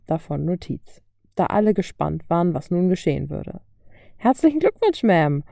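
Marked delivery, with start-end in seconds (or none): 4.2-5.4 mock congratulation, pitched much higher; sarcastic cheer with a grin; grinning call. A narrator's reading. Toni calls out to the reporter, grinning and mocking. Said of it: "rief Toni grinsend".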